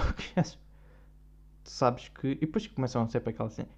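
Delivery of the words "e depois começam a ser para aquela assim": normal talking voice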